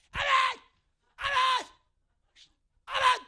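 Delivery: booming amen, high and loud; loud amen shout. Retold or imitated. Pastor showing his chapel days with huge amens. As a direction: bellow amen like the campus amen man. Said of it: "아멘 얼마나 세게 잘하는지 몰라요".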